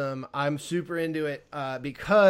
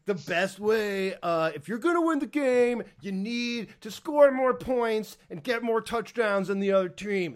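lightly voiced football commentator, higher pitched; John Madden; 6 semitones higher than usual. Mocking a coach's obvious advice on winning, hammed-up commentator. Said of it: "commentating English version of John Madden".

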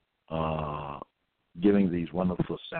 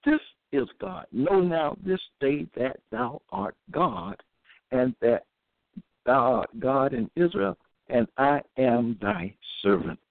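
solemn scripture reading; 7 semitones higher than usual. Acted. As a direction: proclaim each line like holy writ. Reads scripture aloud, raised and slow.

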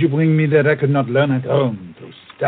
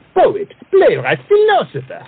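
rising boast; 13 semitones higher, 6 dB louder than usual. Acted. Cyrano boasting his talents.

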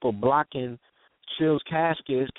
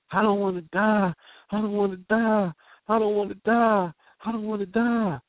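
put-on higher, pleading cry of a scared little boy, repeated; imitating a five-year-old's panicked plea; retold child's terrified scream; 7 semitones higher than usual. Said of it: "screaming at the, his lungs".

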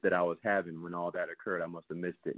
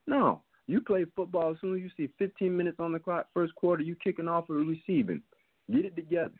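raised-pitch rant at the team, high and fired up; pitched-up scolding rant; heated rant; 7 semitones higher than usual. Real, not acted. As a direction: chew the team out like a frustrated fan. Ranting about slow starts.

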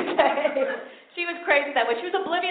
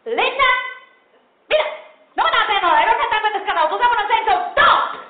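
loud, higher Spanglish scolding; mother screaming; 8 semitones higher, 7 dB louder than usual. Retold or imitated. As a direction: rant at the kid in two languages. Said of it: "just start screaming".

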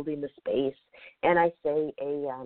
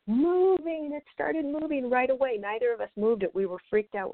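awed retelling; 10 semitones higher than usual. Retold or imitated. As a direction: relive the shock of the piece moving by itself. Board moves on its own.

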